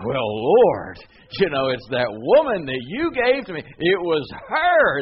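loud, pleading excuse, pointing the finger; Adam's excuse. Acted. Adam blames the woman to God, in a loud, pleading excuse. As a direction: protest loudly and shift the blame onto her. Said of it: "What's Adam's immediate response to that?".

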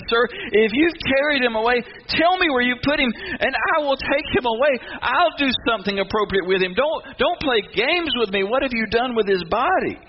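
high, urgent pleading; desperate demand. Acted. Mary to the supposed gardener about the body, desperate. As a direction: plead and demand in one breath.

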